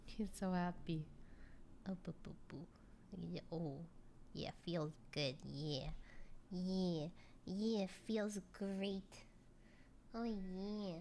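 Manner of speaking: overwhelmed by cuteness. Real, not acted. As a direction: melt over a cute little animal. Baby-talking to a cute pet.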